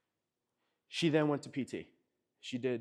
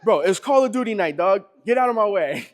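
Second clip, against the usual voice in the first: loud, higher, brushing him off; bro, leave me alone, it's game night; annoyed teen; 8 semitones higher, 16 dB louder than usual. Retold or imitated. Teenage son shouting at dad to go away, loud and annoyed.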